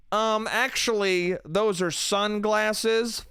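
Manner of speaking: slow, drawn-out know-it-all; mock a smug corrector, stretching the words; pedantic correction.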